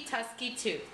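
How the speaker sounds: usual speaking voice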